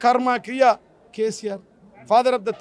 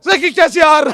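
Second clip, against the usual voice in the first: loud, higher mimicked cry; mock dream outburst; 4 semitones higher, 8 dB louder than usual. Mocking the chief minister's nightmares as his wife tells them, mock dream outburst. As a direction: imitate someone crying out a name in sleep. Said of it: "గీత వదినే అంటుంది".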